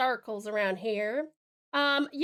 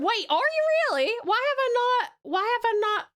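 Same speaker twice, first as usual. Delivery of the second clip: much higher, louder surprised question; 9 semitones higher, 3 dB louder than usual. Real, not acted. Surprised at chat saying Rowan is Southern. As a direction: genuinely caught off guard.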